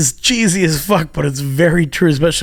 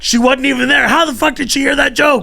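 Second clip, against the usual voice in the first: much higher and louder, baffled disbelief; incredulous outburst; 12 semitones higher, 5 dB louder than usual. Retold or imitated. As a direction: throw it up high in amazed exasperation. Friend retelling baffled outrage.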